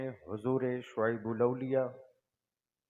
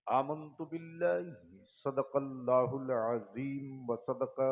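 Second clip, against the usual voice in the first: measured, chanted recitation with drawn-out syllables; Arabic sermon invocation. Real, not acted. Preacher opening his sermon with Arabic praise, intoned. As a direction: intone it steadily, pausing between phrases.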